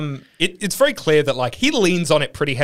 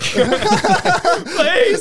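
mock pleading Desperate Dan; 13 semitones higher than usual.

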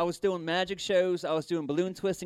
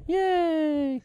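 high, drawn-out puppet cheer; 11 semitones higher than usual. Acted. Puppet celebrates the end of his set, high cheer. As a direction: squeal a little puppet hooray.

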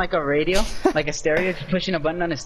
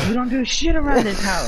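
high, raised hammed-up telling-off; mock parent scolding; 9 semitones higher than usual. Friend mimicking a parent berating a lazy kid, high scolding. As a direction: play an outraged parent laying down the law.

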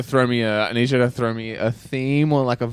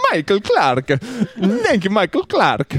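high-pitched, bouncy video-game plumber; Mario; 8 semitones higher, slower than usual. Acted. Thanking a patron by name, high Mario voice. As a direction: pipe up high and sing-song like Mario. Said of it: "Uh, Mario".

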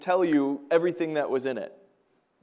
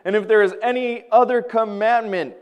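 louder and higher, proclaiming each line; full-force preaching; 5 semitones higher, 7 dB louder than usual. Acted. Preacher reading Romans to the congregation, proclaiming loudly. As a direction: preach the commandments to the room.

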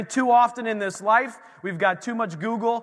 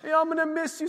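imitated crying; 13 semitones higher than usual. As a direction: play up a parent's weepy farewell. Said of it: "Like, we were crying".

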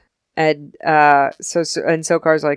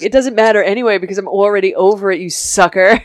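fast, louder gloating villain; gloating taunt; 4 semitones higher, 5 dB louder, faster than usual. Retold or imitated. Apophis taunting Daniel, fast smug gloat. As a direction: rattle it off smugly. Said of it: "He was like".

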